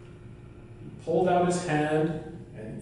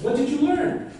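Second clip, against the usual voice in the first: curious wife; 9 semitones higher than usual. Acted. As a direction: ask your husband with a lifted, curious voice. Wife questions Ulf.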